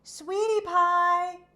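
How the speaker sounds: high loud slow call; crying out. Acted. Kate calling her cow in the gully, high loud call. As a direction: call the cow's name across the field. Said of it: "cried Cowgirl Kate".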